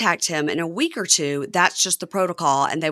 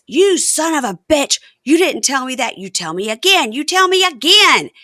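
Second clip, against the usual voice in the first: loud, high, threatening demand; imitated fury; 8 semitones higher, 7 dB louder than usual. Wife confronting husband over moved interview, mock furious. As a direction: act out a wife exploding in his face. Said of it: "she gets all crazy furious".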